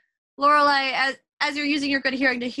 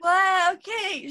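high, cheerful pup voice; high cartoon pup agreeing; ninja pup voice; 8 semitones higher, 3 dB louder, much slower than usual.

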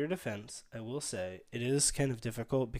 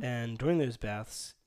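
usual speaking voice

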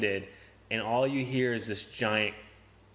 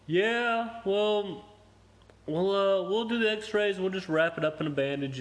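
mock clinic staff; 8 semitones higher, slower than usual. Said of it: "they looked at it, they're like".

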